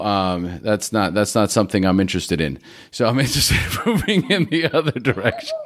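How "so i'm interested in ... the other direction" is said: a real laugh breaking into the words; laughing through it. Real, not acted. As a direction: chuckle as you say it. Jokes about avoiding poverty.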